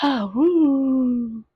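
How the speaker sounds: a loud, high emotional exhale; shaky woo. Real, not acted. Host collecting herself after crying on air, loud high woo. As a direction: blow out the tears with a woo. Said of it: "I'm so emotional".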